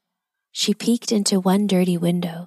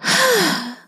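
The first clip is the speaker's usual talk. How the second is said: big deep breath; 9 semitones higher than usual. A narrator's reading. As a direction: take a big breath and let it go. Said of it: "after taking a big, deep breath".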